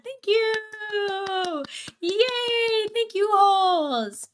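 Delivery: high, drawn-out excited cheer; delighted thanks. Real, not acted. Streamer thanking a viewer for a sub, excited. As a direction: celebrate the gift in a high voice.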